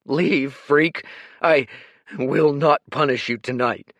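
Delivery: loud, high, grief-torn command; harsh dismissal. Acted. Kuruk ordering Dorian away from the grave, harsh. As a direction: drive him away.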